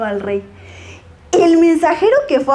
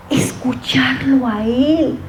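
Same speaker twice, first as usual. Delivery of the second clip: whisper; slower than usual. Real, not acted. Sermon key point, whispered.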